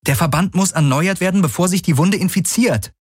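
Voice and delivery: high, firm woman's voice; urgent insistence. Acted. Heroine insists on treating the wound.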